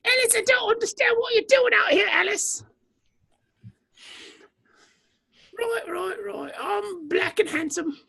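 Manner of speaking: high put-on British voice; brooding English cop, hammed up; mock British Luther. Luther impression bit.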